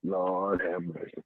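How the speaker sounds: low, slow, soft aside; hushed, weary self-talk; saying to himself. Retold or imitated. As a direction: sigh it to yourself, drawn out and low. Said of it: "I'm saying to myself".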